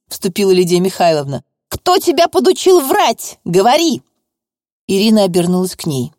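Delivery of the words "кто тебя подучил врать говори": demanding shout. Acted. Prosecutor bullying a girl.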